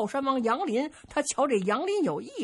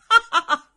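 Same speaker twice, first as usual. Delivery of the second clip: loud, high delighted laugh; hearty laugh; 6 semitones higher, 9 dB louder than usual. Acted. Emperor Yang Guang pleased by the strongman, loud laugh. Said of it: "杨广乐了".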